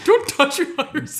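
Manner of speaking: high, giggly half-finished joke; laughing. Real, not acted. Joking with the co-host about touching, laughing. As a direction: talk through the giggles.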